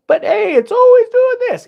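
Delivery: high, loud sing-out of a naive claim; mock cheer. Trader mocking the idea that it always does this, mock cheer. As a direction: pitch it way up, mockingly upbeat.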